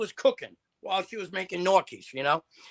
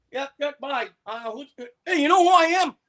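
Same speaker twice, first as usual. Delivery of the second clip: retold boxer swagger; 7 semitones higher, 4 dB louder than usual.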